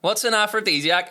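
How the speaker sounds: loud, high curious call up the stairs; child calling up. Retold or imitated. Child calls up the stairs to mum, loud curious call. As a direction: shout the question up to mum.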